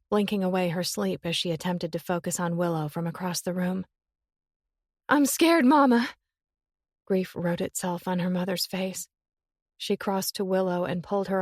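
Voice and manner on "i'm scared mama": higher, louder, scared; frightened. Acted. Willow telling her mother she is scared. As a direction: cry it to her mama.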